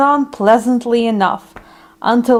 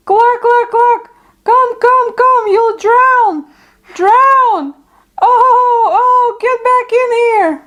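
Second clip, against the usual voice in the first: frantic hen calling; 11 semitones higher than usual. Acted. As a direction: cluck out like a terrified mother hen. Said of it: "calling out in grief and dread".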